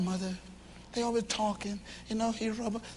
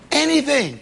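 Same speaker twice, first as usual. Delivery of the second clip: a higher, louder plea, falling at the end; pleading wife; 5 semitones higher, 8 dB louder than usual. Acted. Wife begging her husband to talk, a pleading plea. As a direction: beg him for any word at all.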